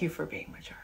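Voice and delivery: soft unvoiced thanks; whisper. Real, not acted. Whispering thanks to viewers for being mature.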